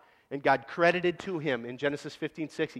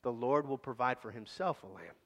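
gentle fatherly reply; 4 semitones lower, 7 dB quieter than usual. Retold or imitated. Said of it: "He says".

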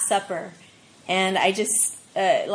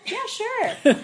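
casual reply; 14 semitones higher than usual. Retold or imitated. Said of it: "They're like".

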